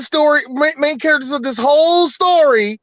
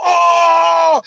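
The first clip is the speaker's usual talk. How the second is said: loud, higher mock "oh"; mock an overacted movie shout; mimicked movie yell; 6 semitones higher, 4 dB louder than usual. Mocking the green-screen scene, hammed-up yell.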